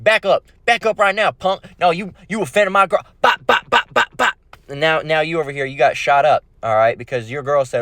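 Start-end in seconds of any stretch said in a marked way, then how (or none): 0.0-3.0 higher, aggressive back-up warning; street tough threat. Acted. Tough guy threatens rival. 3.2-4.3 high mouth-made gunshot pops; gunshot imitation.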